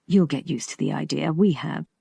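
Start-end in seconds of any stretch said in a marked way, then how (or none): none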